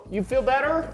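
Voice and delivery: ordinary talk, nothing marked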